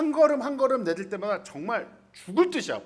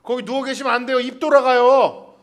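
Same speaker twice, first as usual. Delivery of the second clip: bystander scolding; 2 semitones higher, 8 dB louder than usual. Pretend. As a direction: tell him off for lying there. Imagined bystander scolding Jacob.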